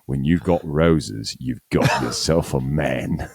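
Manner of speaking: slow, drawn-out Australian tagline; mock a vintage Australian ad voiceover, slowly; Australian accent. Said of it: "Please stay going".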